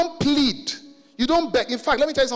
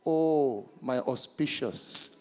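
lower, slow hammed-up pompous pleading; mock archaic flattery; 8 semitones lower, 4 dB quieter, slower than usual. Son mock-flattering his father, lower, slow and hammed-up. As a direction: overdo the flowery old-English begging. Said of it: "my son came to my room and said".

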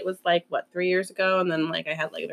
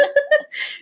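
giggle. Real, not acted. Laughing at the story.

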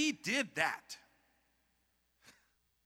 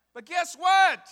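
ordinary delivery

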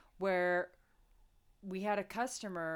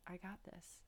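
calm quiet reassurance; 19 dB quieter than usual. Retold or imitated. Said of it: "She's like".

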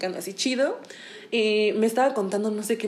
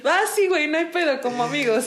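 laughing agreement; 6 semitones higher than usual. Retold or imitated. Retold high casual agreement.

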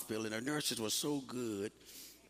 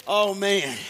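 high, loud oh man; surprised exclaim; 8 semitones higher, 10 dB louder than usual. Real, not acted. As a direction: blurt it out, caught off guard.

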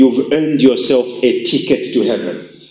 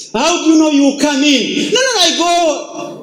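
mock dialogue; 11 semitones higher than usual. Acted exchange at heaven's door.

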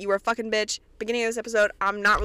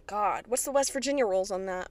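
normal talking voice

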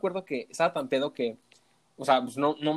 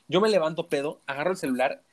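ordinary delivery

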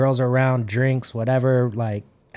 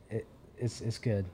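hushed and much softer; quiet; 4 semitones lower, 15 dB quieter than usual. Retold or imitated. Joey quietly vouching for his friend. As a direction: soothe a tense stranger in a hush.

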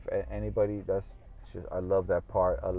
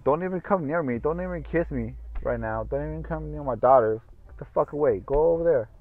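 exasperated scolding; 7 semitones higher, 4 dB louder than usual. Real, not acted. Scolding sick wife.

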